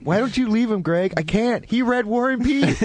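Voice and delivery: high whining plea to leave him; pleading, put-on girlfriend voice; mock whiny girlfriend voice. Friend mocks her ten-year relationship in a high whining voice. Mocking ex-boyfriend conversation, high voice.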